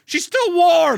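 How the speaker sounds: very high, drawn-out loud cry; mock anguished wail. Hero touching his murdered sister, hammed-up anguished cry. Repeats hero's melodramatic cry.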